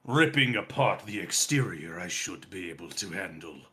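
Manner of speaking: put-on an old dragon sizing up the job; ancient dragon. Dragon offering to rip apart the exterior, low and deliberate.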